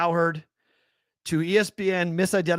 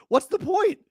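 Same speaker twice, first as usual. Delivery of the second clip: incredulous; 12 semitones higher than usual. Real, not acted. Baffled at two-night draft.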